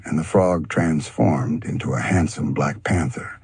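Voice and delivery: usual speaking voice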